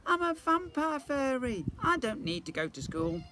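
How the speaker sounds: put-on high, loud childish protest; protesting girl. A narrator's reading. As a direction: insist like a little girl. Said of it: "I said".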